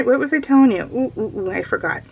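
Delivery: ordinary talk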